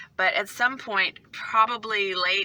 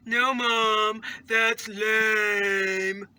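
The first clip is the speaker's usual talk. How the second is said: lightly imitated complaining kid; mock kid protest; put-on kid voice; 2 semitones higher, much slower than usual. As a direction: draw it out like an unimpressed kid. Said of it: "I do those voices".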